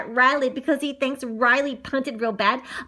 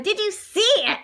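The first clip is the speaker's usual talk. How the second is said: excited shout; 8 semitones higher than usual. A narrator's reading. Boy asking teammates about the monster, high excited shout. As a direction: call out eagerly for witnesses. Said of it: "Riley shouts".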